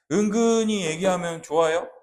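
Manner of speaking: usual speaking voice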